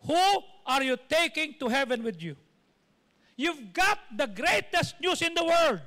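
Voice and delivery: loud, emphatic sermon challenge; forceful preacher addressing the whole church; preaching. Real, not acted. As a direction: drive each question home to the congregation.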